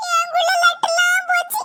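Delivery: put-on child whining tearfully at mother; whiny crying. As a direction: sulk and cry over the sweets. Child whining over lost sweets.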